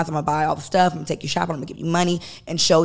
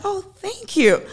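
mock sweet thanks; 13 semitones higher, slower than usual. Acted sweet girly thanks.

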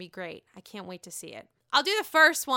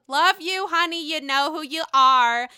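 playful shout-out; 5 semitones higher, 9 dB louder than usual. Real, not acted. Host addressing an unnamed bestie, loud playful shout-out. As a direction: call it out playfully to a friend.

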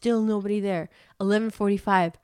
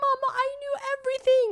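put-on much higher, fast little kid voice; child's voice; faster than usual. Little daughter telling her mama she knew everything, much higher. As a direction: boast like a proud toddler. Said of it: "And Tamara's like".

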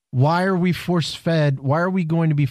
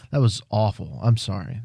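ordinary talk, nothing marked

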